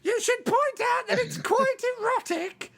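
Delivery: very high, light falsetto of a doting mother; mock falsetto mother; ghost mother voice. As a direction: squeak it out like a sweet old ghost mum. Both voicing Daryl's ghost mother.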